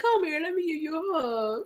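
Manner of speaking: put-on high, soft coo to a child; cooing comfort. Retells comforting her nephew.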